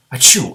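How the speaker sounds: big hammed-up dragon sneeze; loud sneeze. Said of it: "Every time the gray green, green dragon sneezed".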